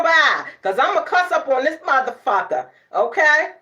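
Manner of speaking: loud, high, fired-up rant; heated rant. Real, not acted. Telling off the commenter about her wig, loud sassy rant.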